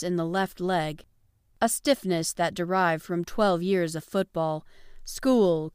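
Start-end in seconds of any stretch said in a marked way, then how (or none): none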